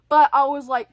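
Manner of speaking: usual speaking voice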